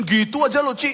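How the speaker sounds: high, loud dismissive jab; mocking brush-off. Offended friend complaining to the pastor, high and loud. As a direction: dismiss her tears sharply. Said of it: "dari sisi orang tersebut".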